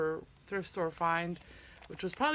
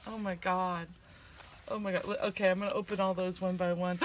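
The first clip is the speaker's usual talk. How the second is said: normal talking voice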